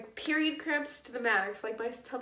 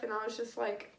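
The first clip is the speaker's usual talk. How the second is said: normal talking voice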